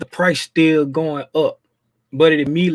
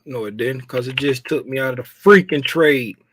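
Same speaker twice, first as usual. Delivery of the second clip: usual speaking voice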